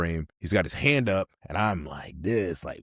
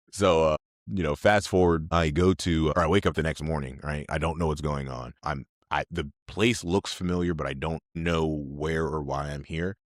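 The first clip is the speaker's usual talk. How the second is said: ordinary delivery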